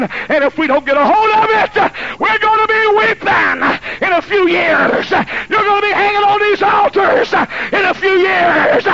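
high, loud, slow preaching shout; preaching at full force. Acted. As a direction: warn the congregation at the top of the voice.

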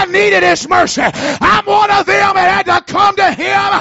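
loud, high sermon climax, slowing on key lines; full-force preaching. Acted. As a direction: build back up to a shouting preach.

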